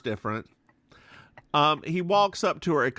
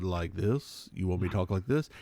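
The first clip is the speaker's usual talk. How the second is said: lower voice; 7 semitones lower, 4 dB quieter, faster than usual. Retold or imitated. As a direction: drop the voice much lower, mock-seductive. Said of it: "can you speak in a, in a lower voice?".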